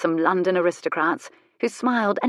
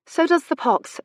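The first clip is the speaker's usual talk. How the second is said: brisk, higher-pitched retort; 10 semitones higher than usual. A narrator's reading. Woman answering a rake's boast about his experience, brisk. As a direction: toss it back briskly, pitched up. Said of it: "she said briskly".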